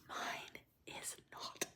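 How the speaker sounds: very soft, slow whisper; whispered confession. Real, not acted. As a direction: whisper it like an awkward admission. Confessing her own love language.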